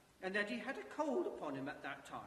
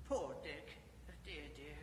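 sad; 4 semitones lower, 5 dB quieter than usual. Acted. Scrooge mourns lost friend.

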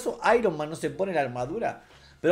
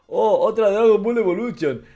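mock critic's groan, louder; mocking critics; 4 semitones higher, 6 dB louder than usual. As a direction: sneer it like a fed-up fan. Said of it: "va a haber mucha gente que va a decir".

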